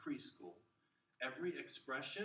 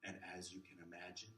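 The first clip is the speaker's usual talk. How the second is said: a hint of a slow, lower droning lecturer; monotone teacher; 5 semitones lower, 4 dB quieter, slower than usual. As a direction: drone on, mildly imitating a dull professor. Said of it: "boring, same tone".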